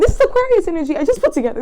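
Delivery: loud, high burst on Aquarius; excited realisation. Real, not acted. Tarot reader realising the card meaning, loud and excited. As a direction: blurt it out as it clicks.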